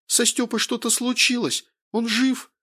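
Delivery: high, frantic woman's questioning; verging on hysteria. A narrator's reading. Wife fears for her husband, high near-hysterical voice. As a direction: plead for news, close to hysterics. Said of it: "Голос в телефоне был на грани истерики".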